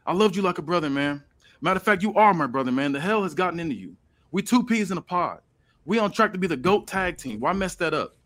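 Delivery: lightly voiced pleading wrestler; voicing Jimmy. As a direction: plead with your twin, lightly acted. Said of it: "He's like".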